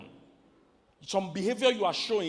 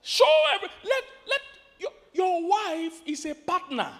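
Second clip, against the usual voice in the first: high loud cry, slowing at the end; preacher crying out that a wife is a partner, loud and high; loud, high proclamation; 12 semitones higher, 4 dB louder than usual. Real, not acted. Preacher hammering partner point.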